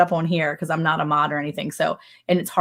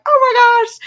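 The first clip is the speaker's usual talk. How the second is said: very high, thrilled gasp of delight; excited squeal; 8 dB louder than usual. Retold or imitated. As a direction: squeal it like you just heard your name. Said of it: "I'm like".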